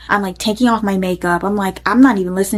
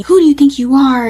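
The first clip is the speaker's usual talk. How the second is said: imitating an angry yell, higher and louder; mock yell, raised pitch; retold yelling; 6 semitones higher, 6 dB louder than usual. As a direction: retell his shouted challenge with force. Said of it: "So he's yelling at me".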